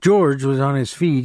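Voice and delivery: ordinary delivery, nothing marked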